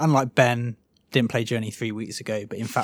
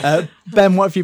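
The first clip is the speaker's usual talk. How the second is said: laughing; 6 semitones higher, 9 dB louder than usual. Real, not acted. Laughing as he hands over.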